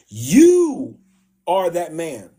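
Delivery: hammed-up prophet exposing the guilty king; dramatic accusation. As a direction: land the accusation slowly. Retold prophet's accusation of King David.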